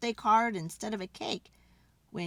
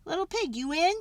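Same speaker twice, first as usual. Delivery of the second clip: light sing-song call to the pig's door; mock-polite calling at the door; 7 semitones higher than usual. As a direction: call through the door, half-hearted. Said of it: "so I called".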